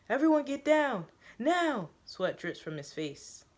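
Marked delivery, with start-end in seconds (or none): 0.1-1.9 higher, louder urgent order; yelled command. A narrator's reading. Teo yelling at the room, frantic shout. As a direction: bark at everyone to get down. Someone yelling to take cover.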